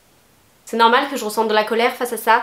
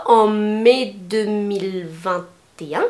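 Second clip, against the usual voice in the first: ordinary delivery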